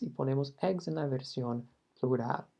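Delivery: usual speaking voice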